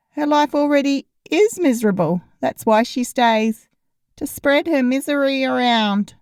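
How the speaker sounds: put-on deadpan teasing teen; wry teenage boy voice. A narrator's reading. Lennon's wry remark.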